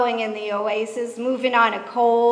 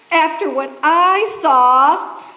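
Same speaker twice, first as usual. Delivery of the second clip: slow, louder, breathless and dramatic; gasped, slow and ominous; 4 semitones higher, 7 dB louder, slower than usual. A narrator's reading. Miriam still winded, hinting at what she saw, slow and breathless. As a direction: drag it out, haunted. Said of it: "she gasped".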